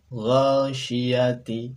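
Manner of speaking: "Ghasyiyati" very slow and loud, ending ti; model Arabic reading. Shown as a demonstration. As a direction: stretch out "Ghasyiyati". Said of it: "samakan dengan contoh pelafalan kami". ("Ghasyiyati" dibaca sangat pelan)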